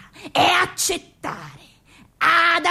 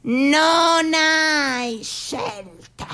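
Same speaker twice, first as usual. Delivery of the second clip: very slow verdict; much slower than usual. Acted. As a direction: drag it out, very slow. Dramatic final verdict, very slow. (molto lento, solenne)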